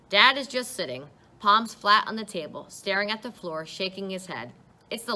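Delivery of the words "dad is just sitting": usual speaking voice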